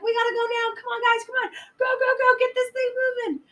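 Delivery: high, frantic rush of orders; panicked urging. Retold or imitated. Tim untying the rope as Missy nears, frantic urging. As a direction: hurry the crew along in a mock panic.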